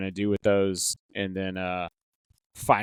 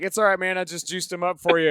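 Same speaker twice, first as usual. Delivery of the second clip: cocky reassurance; 8 semitones higher than usual. Retold or imitated. Narrator reassures player in story.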